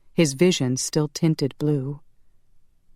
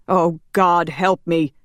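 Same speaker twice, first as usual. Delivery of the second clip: mildly raised, anguished appeal; anguished shout; 3 semitones higher, 5 dB louder than usual. A narrator's reading. Roman pleads over the dying priest, restrained shout. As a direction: cry out to God, restrained. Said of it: "Roman shouted".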